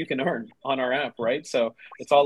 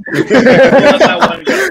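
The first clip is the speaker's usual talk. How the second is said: high, loud and slow through laughter; laughing it off; 11 semitones higher, 16 dB louder, slower than usual. Real, not acted. Guest laughing off the host's Yankees cheer. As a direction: wave it away while cracking up.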